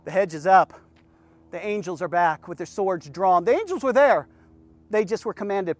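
low, very soft preaching with long pauses; hushed sermon appeal. Real, not acted. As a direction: bring it right down and question them gently. Hushed, searching appeal to congregation.

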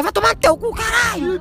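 high, shouted curse at a teammate; mock angry shout. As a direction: yell it like a furious country player. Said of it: "E tu chegar e fala".

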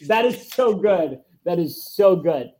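loud, giddy fan praise; delighted. Retold or imitated. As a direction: gush with delight. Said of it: "And I'm just".

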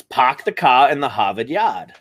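slow Boston accent, every R dropped. Shown as a demonstration. Co-host saying the line on request, Boston accent. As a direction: demonstrate broad Boston vowels, unhurried. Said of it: "Yeah, say that".

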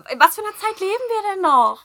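high, loud, exasperated; indignant outburst. Real, not acted. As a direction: throw up your hands in disbelief. Indignant about racism against friend. (empört und laut)

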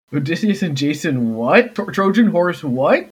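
teasing outrage; mock disbelief. Real, not acted. GM listing sneaky heroes.

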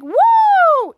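high, loud "woo"; long ringing cheer; excited whoop. Retold or imitated. Team cheering the road trip, loud high whoop.

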